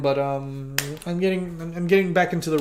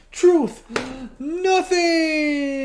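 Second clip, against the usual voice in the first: dramatic reveal; 11 semitones higher, much slower than usual. Real, not acted.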